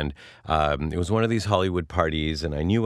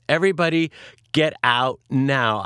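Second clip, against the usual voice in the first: commanding; 7 semitones higher, 4 dB louder than usual. Retold or imitated. Woman ordering everybody out of her room, commanding. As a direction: clear the room with spaced-out, raised-pitch words.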